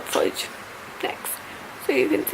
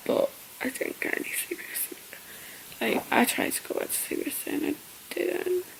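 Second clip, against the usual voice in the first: whispering; 2 semitones higher, 10 dB quieter than usual. Real, not acted.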